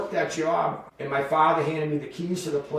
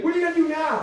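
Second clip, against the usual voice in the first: high mock-baffled question; incredulous; 11 semitones higher, 4 dB louder, much faster than usual. Coworker back at the funeral home, high imitated disbelief. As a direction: imitate a bewildered coworker, pitch jumping up.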